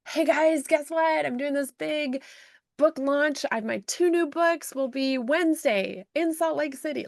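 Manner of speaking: bright, high-pitched hype to her followers; excited announcement. Retold or imitated. Author announcing her book launch to fans, excited high voice. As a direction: sell the big news with a lifted voice.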